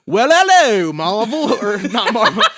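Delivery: loud, high, hammed-up British accent with laughter; cheeky British boy, cracking up; British voice taunting Marvel. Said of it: "it would have been more like".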